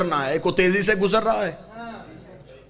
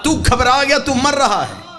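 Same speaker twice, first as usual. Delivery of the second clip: high, loud sermon climax building to a shout; full-force preaching; 8 semitones higher, 8 dB louder than usual. Real, not acted. Preacher contrasts birth with death for congregation, high full-force preaching. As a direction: drive it home at full voice, pitched high.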